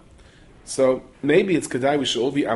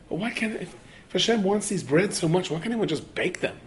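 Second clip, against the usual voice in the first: higher-pitched, puzzled protest; baffled questioning; 8 semitones higher than usual. Acted. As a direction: act out a congregant earnestly wondering why not. Naive congregant puzzles over rabbi's words.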